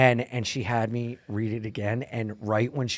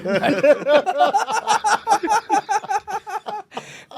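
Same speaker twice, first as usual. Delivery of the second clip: high laugh; 4 dB louder than usual. Real, not acted. Big laugh.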